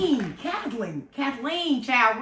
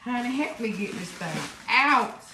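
ordinary talk, nothing marked

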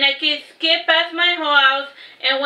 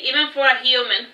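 ordinary delivery

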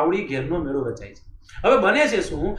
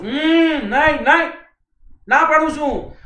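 refusing Thakor; 7 semitones higher, 4 dB louder, slower than usual. Retold or imitated. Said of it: "એમનો કહેતા".

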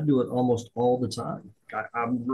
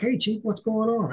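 bright, higher hey to the boss; cheery greeting; 9 semitones higher than usual. Retold or imitated. NCO greeting the chief, cheerfully higher. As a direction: greet him like you're glad he came.

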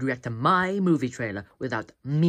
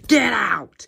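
angry shout; 9 semitones higher, 10 dB louder than usual. Real, not acted. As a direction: yell get out, loud and high. Yelling at co-host.

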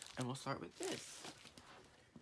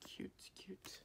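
soft unvoiced whisper; 6 semitones higher, 12 dB quieter than usual. Real, not acted. Whispering to herself over cute freebies. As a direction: breathe it out under your breath.

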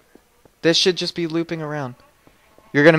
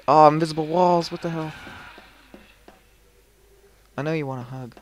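ordinary delivery

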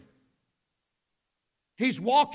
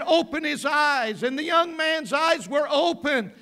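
loud, higher climactic preaching; preaching at full force; 4 semitones higher, 8 dB louder than usual. Real, not acted. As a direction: build the sermon to its peak.

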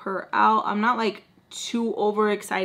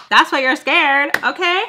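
sassy callout; 6 semitones higher, 11 dB louder than usual. Retold or imitated. Sassy retort to Baltimore.

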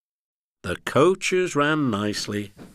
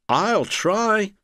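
put-on high-pitched old engine voice; 10 semitones higher than usual. A narrator's reading. Old engine offering to help the controller, high willing voice. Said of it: "answered the old engine".

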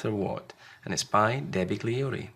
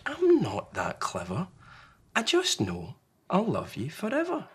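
gentle, rising heartfelt answer; Large, tender parent; 9 semitones higher than usual. Acted. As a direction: promise it sincerely. Large comforts Small.

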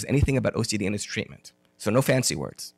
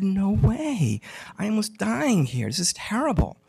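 high-pitched, mock-desperate refusal; distressed protest; 7 semitones higher than usual. Friend refusing to sit through horror movie again, distressed protest. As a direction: retell it as a pleading, overwrought objection.

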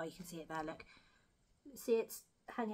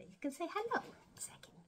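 whisper; 12 semitones higher than usual. Real, not acted. To the hamster in her hands, whispered. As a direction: hush it to the little hamster.

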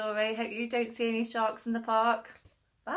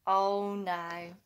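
usual speaking voice

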